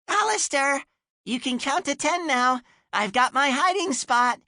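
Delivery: very high, bright chirp; chirpy little voice. Acted. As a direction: chirp it like an excited small child. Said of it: "Aggie's voice chirps out".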